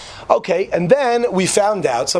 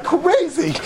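amazed; 11 semitones higher than usual. Retold or imitated. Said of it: "And I'm thinking".

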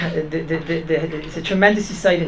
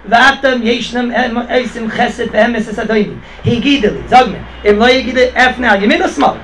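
louder, higher recital, building in force; declaimed quoted speech; 4 semitones higher, 5 dB louder than usual. Retold or imitated. Retold servant addressing the family about the match, declaiming.

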